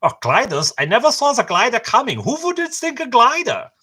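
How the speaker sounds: a baffled German guard, pitch climbing; German accent. Retold or imitated. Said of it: "I shouldn't say that with a British accent".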